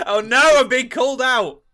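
loud, high mock panic; mock dismay. Co-host feigns horror at being called out, mock wail. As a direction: cry out as if caught, hammed-up.

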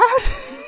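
sudden high laugh. Real, not acted. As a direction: burst into a laugh. Laughs after own realization.

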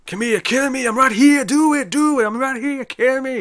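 put-on high, shouted Schwarzenegger taunt with a heavy Austrian accent; Arnold impression. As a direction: goad the monster like Arnold, voice pushed up high. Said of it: "He's going full Arnold".

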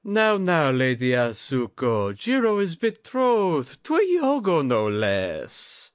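high, loud teasing lord voice; teasing old lord. A narrator's reading. Yasuhide tells Lady Atsuko of Jiro's betrothal, teasing high. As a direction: chide her playfully as the drunk lord. Lord teases guest about Jiro.

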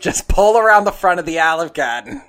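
loud, laughing Boston driver yelling; Boston accent rant. Retold or imitated. Friend voicing a Boston dad yelling at Dee Dee about parking, in Boston accent. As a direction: bark it like an angry Bostonian, cracking up. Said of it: "Boston accent".

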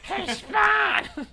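high, loud cartoon voice; a silly cartoon greeting; high goofy voice. Goofy character voice.

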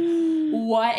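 mock-stunned, stretch it out; retold shock. Retelling own reaction as windows get banged, shocked. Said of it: "I'm in shock".

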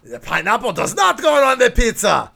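high, indignant mock Russian accent; attempted Russian accent. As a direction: object in a put-on Russian accent. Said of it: "trying to do a Russian accent".

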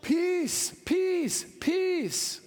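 PEACE called out high and loud each time; emphatic repeat. Shown as a demonstration. Pastor leading the congregation, "Peace" called out high and loud. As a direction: hammer "peace" three times. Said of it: "Say it again".

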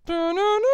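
sung high three-note beep; phone error tones. Retold or imitated. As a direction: chant the error jingle high. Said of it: "it goes".

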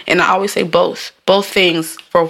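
normal talking voice

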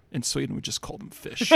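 put-on hushed, half-whispered girlfriend deadpan; dry quiet punchline from the Swedish girlfriend. Swedish girlfriend correcting him about fish, in a hushed deadpan. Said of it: "And she's like".

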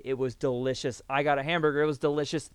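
ordinary talk, nothing marked

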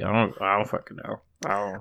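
low, soft mumbled admission; clueless giving up. Retold or imitated. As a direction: drop the voice and give up. Retelling own clueless giving up.

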